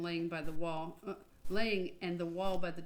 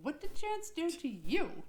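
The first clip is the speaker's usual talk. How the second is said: mock outrage; 9 semitones higher, slower than usual. Real, not acted.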